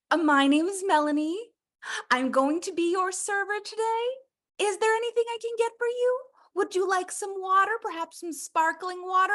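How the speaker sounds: high, gushing people-pleasing waiter voice; overeager waiter. Acted. Eager waitress hovering over diners, high and gushing. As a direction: gush at the table like a desperate server. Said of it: "the waiter is like".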